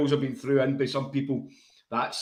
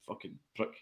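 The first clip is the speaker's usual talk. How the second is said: Eton toff; 5 semitones lower, 14 dB quieter than usual. Retold or imitated.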